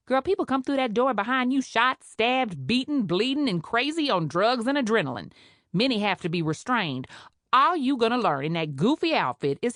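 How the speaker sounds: brassy Creole nurse, sharp and scornful; scolding Creole head nurse. Acted. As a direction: put the greenhorn in her place. Head nurse lectures new doctor.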